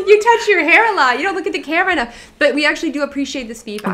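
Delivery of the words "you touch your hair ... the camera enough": high, loud, fast mock critic; hammed-up voice of a picky commenter; mock nagging viewer.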